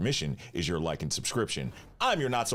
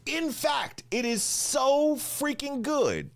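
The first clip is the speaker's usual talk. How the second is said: high-pitched slow gush on so freaking good; excited high squeal; slower than usual. Real, not acted. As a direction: squeal it with delight. Hyping the live stream.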